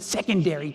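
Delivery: "secondary" set apart with disdain; pointed word. Retold or imitated. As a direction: lean on the first syllable of "secondary". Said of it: "That's the way she said it".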